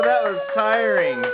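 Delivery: ordinary talk, nothing marked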